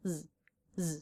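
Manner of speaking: "z" alone as a short voiced buzz; isolated Z sound. Shown as a demonstration. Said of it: "with a Z sound".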